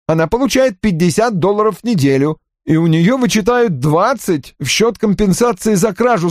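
high, eager-to-justify report; offended young subordinate reporting. Acted. Voices Johnny defending his report. (высоким голосом обиженного подчинённого)

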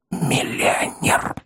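slow, voiceless confidential whisper; whisper in the ear. A narrator's reading. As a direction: whisper it slowly, as a secret. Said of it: "а затем шепнул на ухо". (медленный шёпот на ухо)

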